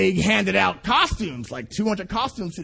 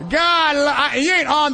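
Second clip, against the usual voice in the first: loud outburst; 6 semitones higher, 5 dB louder than usual. Real, not acted. Preacher's outburst.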